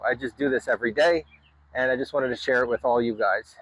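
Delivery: ordinary talk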